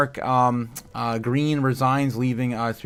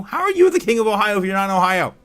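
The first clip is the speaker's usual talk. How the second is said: fast and louder, a bit higher; excited teasing; 8 semitones higher, 7 dB louder, faster than usual. Real, not acted. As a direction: tease him with a quick, pitched-up jab. Teasing a viewer in chat.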